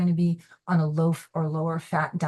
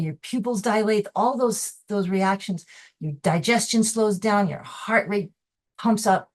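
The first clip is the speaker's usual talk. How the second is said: ordinary delivery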